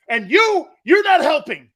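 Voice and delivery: loud high scold; scolding shout. Retold or imitated. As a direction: snap at him like an angry mother. Mom scolding narrator.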